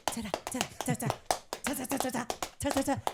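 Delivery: rhythmic mock tap-dance syllables; playful ta-ta rhythm mimicking feet; tap dancing sounds. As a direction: sound out her stumbling feet like a tap routine. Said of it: "like, with her feet".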